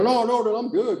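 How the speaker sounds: light, high-voiced brush-off; retold high-pitched denial. Man telling police he has no warrant, high-pitched denial. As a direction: pitch it way up as you deny it.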